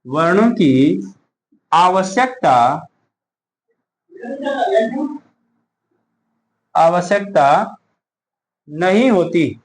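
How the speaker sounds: word by word, pausing for pens; slow dictation. Shown as a demonstration. Teacher dictating notes to students, slow and word by word. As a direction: dictate each word slowly so they can write.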